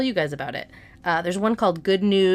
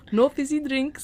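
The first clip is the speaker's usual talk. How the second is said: higher-pitched imitation of a sweet man; imitated catchphrase; 6 semitones higher than usual. Host quoting a viral man's catchphrase, higher-pitched imitation. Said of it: "That's his catchphrase".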